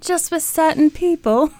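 high-pitched put-on British voice; British accent. Psychic stranger explaining her gift to Ralph, put-on British accent. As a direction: keep up the mock British woman. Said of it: "my imitation of her British accent".

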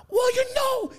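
high East Coast accent impression; Peter Dante. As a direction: put on a hammed-up East Coast voice. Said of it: "his East Coast".

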